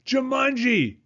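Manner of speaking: high, loud yell. A narrator's reading. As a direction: yell it as loud as she can. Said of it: "she yelled as loud as she could".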